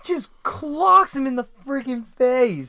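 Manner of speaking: loud, high-pitched relish; excited exclamation. Real, not acted. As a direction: blurt it out with emphatic glee. Excited reaction to the punch.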